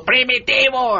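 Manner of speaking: high mock yell of a name; imitated shout. Imitating a moviegoer yelling out the film's title, mock shout. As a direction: call it out like someone in the audience. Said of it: "había alguien gritando".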